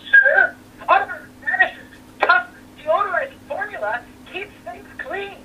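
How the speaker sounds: very high cartoon toilet voice; high-pitched commercial cartoon character; talking toilet voice. Shown as a demonstration. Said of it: "can you do your toilet voice?".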